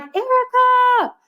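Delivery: high, drawn-out call across an airport; calling out a name. Retold or imitated. Stranger calling out Erica across an airport. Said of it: "you hear somebody yell".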